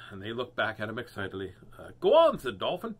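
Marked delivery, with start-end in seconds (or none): 2.0-2.4 high, loud nudge; eager urging. Acted. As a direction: egg on a shy friend. Dolphin urging shy turtle.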